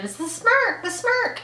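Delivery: high, excited fan shout; excited scream. Retold or imitated. Fan in the audience screaming at the stage. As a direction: shout it out from the crowd. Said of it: "somebody in the audience screaming".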